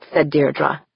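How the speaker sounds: usual speaking voice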